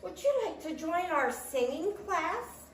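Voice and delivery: high-pitched chirping invitation; chirpy robin. A narrator's reading. Robin inviting Tortoise to singing class, chirpy. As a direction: keep it high, a cheery little bird. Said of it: "chirped Robin".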